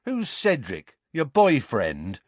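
high, mocking question; jeering. A narrator's reading. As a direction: taunt him about a crush. Dudley jeering at Harry's nightmare.

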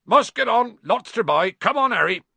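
high, loud, gruff call; calling over babble. A narrator's reading. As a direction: shout to be heard over a crowd. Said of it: "make himself heard over the babble".

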